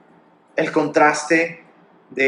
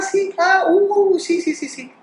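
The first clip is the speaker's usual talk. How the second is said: absent-minded father; slower than usual. Retold or imitated. Distracted father brushing child off.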